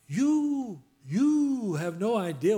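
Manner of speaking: high, drawn-out YOU; emphatic teasing retort. Real, not acted. Preacher answering those who find church loud, teasing emphatic retort. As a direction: swoop up high and stretch the YOU. Retorting to complainers about loudness.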